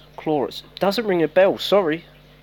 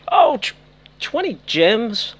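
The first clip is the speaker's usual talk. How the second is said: much higher, drawn-out and slow in disbelief; surprised; 9 semitones higher, 5 dB louder, slower than usual. Real, not acted. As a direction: stretch "twenty gems?" in slow, high, disbelieving surprise. Reading a disappointing chest reward.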